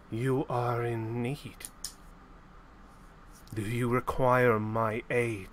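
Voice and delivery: slow, low voice from the darkness; lightly voiced, like an old mentor calling; mysterious voice. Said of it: "you hear a voice come out of the darkness".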